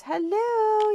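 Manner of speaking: gleeful high hello about the Steelers win; sing-song hello. Real, not acted. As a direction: toss in a high cheeky hello. Cheering Steelers win aside.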